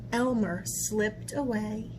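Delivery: usual speaking voice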